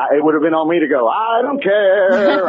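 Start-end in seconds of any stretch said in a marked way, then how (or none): 1.1-2.5 drawn-out, slow, higher dismissive "I don't care"; Meanie's mock response. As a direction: stretch out "don't" lazily, like you couldn't care less. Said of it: "the perfect Meanie response to that".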